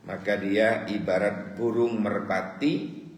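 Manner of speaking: usual speaking voice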